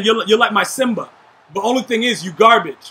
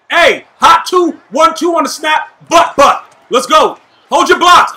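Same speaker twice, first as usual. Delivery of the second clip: calling the play; 6 semitones higher, 6 dB louder than usual. Real, not acted. Calling the snap count loudly.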